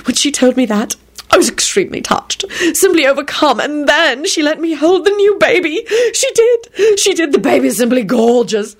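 high, gushing and overwrought; melodramatic. A narrator's reading. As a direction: ham up the adoration. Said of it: "he pronounced melodramatically".

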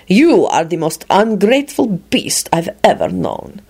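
measured icy condemnation; glacial. A narrator's reading. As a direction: condemn him with contained coldness. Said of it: "replied in a glacial tone".

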